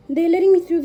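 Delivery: high, loud "letting me through"; thrilled, rushing the words; excited. Real, not acted. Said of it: "They're so nice".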